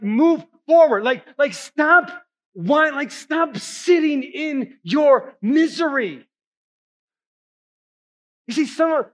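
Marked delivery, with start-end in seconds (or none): 0.0-6.3 insistent God. Retold or imitated. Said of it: "God's like".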